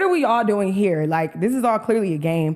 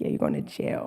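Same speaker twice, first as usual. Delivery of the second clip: quoted cop; 7 dB quieter than usual. Retold or imitated. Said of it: "He's like".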